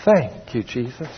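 hushed, gentle thanks; quiet little prayer of thanks. Shown as a demonstration. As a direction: say it softly, fading almost to nothing. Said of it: "You could do it a little softer".

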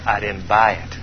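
low, soft, knowing reply; hushed fatherly answer; quiet reply. Acted. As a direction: say it low and secretive.

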